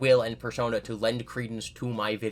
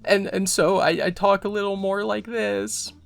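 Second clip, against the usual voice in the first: put-on much higher, louder little-boy voice; whimpering child; 10 semitones higher, 7 dB louder than usual. Shown as a demonstration. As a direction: talk like a whiny little child. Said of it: "I need to sound like a whimpering child".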